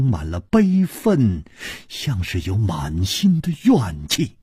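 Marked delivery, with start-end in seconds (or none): none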